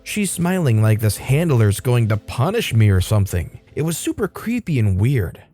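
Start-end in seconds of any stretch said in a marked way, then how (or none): none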